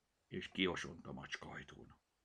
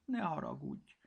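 shy kitten; 8 semitones higher than usual. Acted. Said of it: "szégyenlősen a kis cica".

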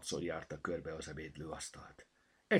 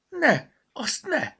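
high, loud, drawn-out cry of protest; alarmed shout; 11 dB louder, much slower than usual. A narrator's reading. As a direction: shout to stop someone at once. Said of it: "kiáltotta Bogi". (ijedt, éles kiáltás)

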